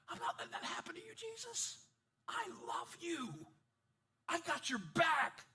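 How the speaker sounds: a hint of devoted Peter; lightly voiced loyal friend. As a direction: swear loyalty with heart.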